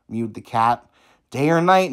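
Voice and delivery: ordinary delivery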